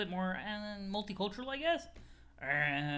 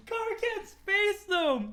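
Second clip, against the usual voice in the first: laughing; 13 semitones higher, 5 dB louder, much slower than usual. Real, not acted. Still laughing at the joke.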